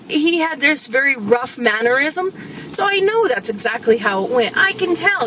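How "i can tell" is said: ordinary talk, nothing marked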